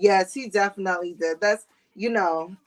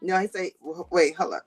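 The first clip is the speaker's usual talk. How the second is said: ordinary talk, nothing marked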